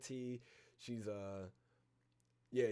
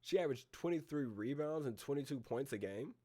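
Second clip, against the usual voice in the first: higher, rising disbelief; incredulous question; 5 semitones higher, 4 dB louder than usual. Real, not acted. Host double-checking a player's stat line, incredulous. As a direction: question the stat as you read it.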